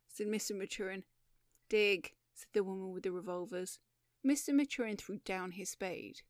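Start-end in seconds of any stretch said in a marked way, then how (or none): 1.7-2.1 loud, curt command; one sharp loud word; barked order. Acted. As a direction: bark it out as an order not to be questioned. Armed woman repeats her order.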